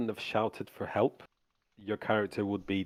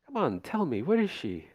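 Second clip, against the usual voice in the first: high, insistent coaxing; demanding vampire; 7 semitones higher than usual. Acted. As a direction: press them to give her up. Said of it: "looking around the mansion going".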